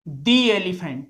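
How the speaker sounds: the said as thee. Shown as a demonstration. Teacher confirming thee before a vowel. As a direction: thee elephant. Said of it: "दी पढ़ेंगे".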